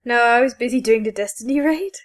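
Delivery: ordinary talk, nothing marked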